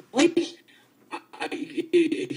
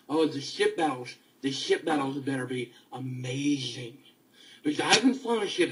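usual speaking voice

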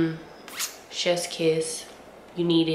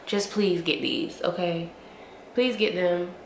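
ordinary delivery

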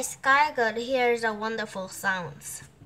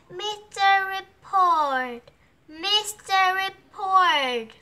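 high, slow sing-out call; 9 semitones higher, 4 dB louder, slower than usual. Acted. Turtle calls to the drum owner in his tree, high. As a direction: call up high, drawing the name out.

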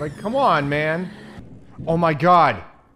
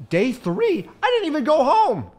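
high exclamation building to a loud wail; indignant disbelief; 11 semitones higher, 5 dB louder than usual. Real, not acted. Player learning day three has started, indignantly wailing. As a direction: protest loudly, pitch shooting up.

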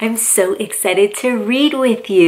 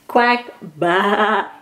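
usual speaking voice

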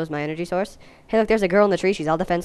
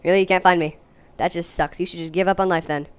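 ordinary delivery

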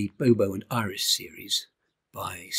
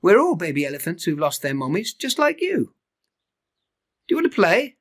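a touch higher friendly calf; lightly voiced Tabu; 8 semitones higher, 7 dB louder than usual. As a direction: bigger calf welcoming him.

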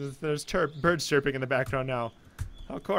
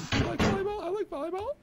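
silly high voice; 5 dB quieter than usual. Pretend. Repeating line in silly voices.

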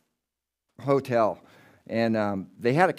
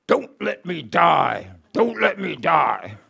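put-on slow, pleading Middle European accent; a dying woman's slow Middle European plea; 4 semitones higher, 5 dB louder, slower than usual. Dying patient begging the nurse, slow Middle European accent. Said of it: "in a Middle European accent is saying".

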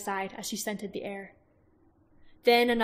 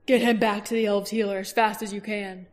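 louder urgent order; firm command; 6 dB louder than usual. Acted. Roran ordering warriors to carry Baldur, firm command. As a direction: push them off with an order.

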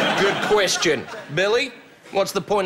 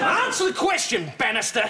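high-pitched impatient order; sharp demand; 6 semitones higher, faster than usual. Acted. Boss ordering Bannister to answer, high impatient demand.

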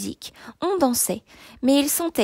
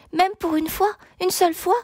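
insistant; 9 semitones higher than usual. A narrator's reading. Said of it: "insista la dame".

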